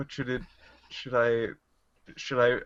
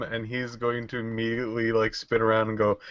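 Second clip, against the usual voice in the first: ordinary talk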